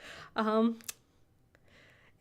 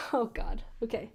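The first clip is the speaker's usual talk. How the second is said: usual speaking voice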